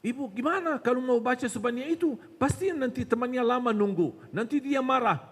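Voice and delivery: high-pitched nagging tempter; bisikan syaitan. Acted. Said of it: "langsung dibisikin terus". (setan mendesak dengan nada tinggi)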